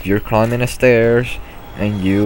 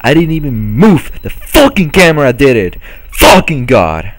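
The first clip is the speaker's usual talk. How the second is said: furious shout; 6 semitones higher, 7 dB louder than usual. Real, not acted. Raging at a game death.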